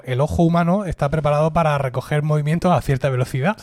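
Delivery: usual speaking voice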